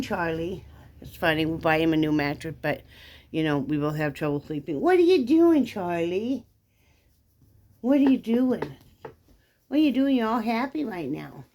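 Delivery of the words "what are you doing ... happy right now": high pet talk. Real, not acted. Owner cooing at her excited dog, high baby talk.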